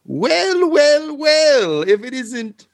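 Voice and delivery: high, loud, drawn-out grand greeting; flustered dramatic villain. Acted. Flustered host attempts a dramatic reveal, high and hammy. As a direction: stretch out each well for a theatrical reveal. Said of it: "slightly out of breath and a little bit flustered".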